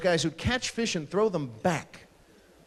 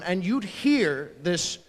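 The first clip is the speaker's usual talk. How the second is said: ordinary talk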